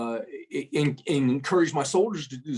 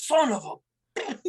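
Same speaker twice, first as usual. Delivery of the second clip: slow, higher, drawn-out cut-off curse; startled curse; 7 semitones higher, much slower than usual. Retold or imitated. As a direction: drag out the half-finished curse, pitched up. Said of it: "He goes".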